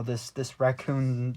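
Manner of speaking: normal talking voice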